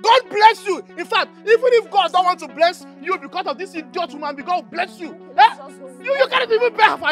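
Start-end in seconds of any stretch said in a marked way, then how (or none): 0.0-0.8 loud mock blessing; sarcastic blessing. Man blessing the idiot woman, sarcastic and loud. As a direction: throw the blessing at them like an insult.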